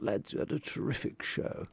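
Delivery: hushed, upper-class impersonation; George Martin. Retold or imitated. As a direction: imitate a refined producer paying a compliment. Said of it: "he's very posh".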